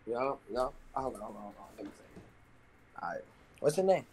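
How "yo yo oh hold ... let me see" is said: normal talking voice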